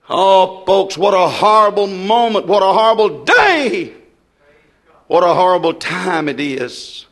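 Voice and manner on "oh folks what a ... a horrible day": ordinary delivery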